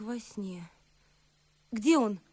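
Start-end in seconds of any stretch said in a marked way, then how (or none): 1.7-2.2 louder anxious demand; sudden urgent question; urgent cry. Acted. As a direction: call out for him. Sofia wakes, demanding.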